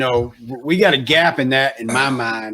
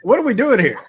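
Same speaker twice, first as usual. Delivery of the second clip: much higher, incredulous "What are we doing here"; exasperated disbelief; 8 semitones higher, faster than usual. Retold or imitated. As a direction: throw the question up in mock outrage. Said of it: "I'm like".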